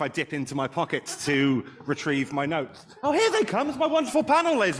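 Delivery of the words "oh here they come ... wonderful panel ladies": much higher and louder, laughing with delight; excited welcome. Real, not acted. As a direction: greet them like a thrilled host. Host greets panel walking on.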